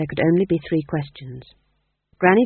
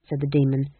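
ordinary delivery

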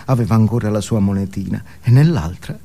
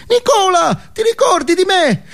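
much higher tiny inner voice; little voice; 4 dB louder than usual. Acted. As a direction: squeak it like a small inner child calling. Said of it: "ho sentito una vocina". (vocina acuta del bambino interiore)

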